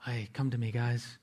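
soft, low, laid-back call; mellow whisper-like. Shown as a demonstration. Preacher mocking a lazy reading of Jesus, quiet and mellow. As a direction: say it all quiet and calm, as the wrong reading. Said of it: "very mellow".

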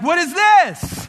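high, slow, disbelieving "what is this"; exasperated outburst. Retold or imitated. Stuck behind a slow driver, high drawn-out disbelief. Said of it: "and you're like".

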